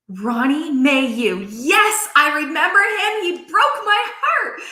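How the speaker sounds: name lands as a sudden memory; excited recognition. Retold or imitated.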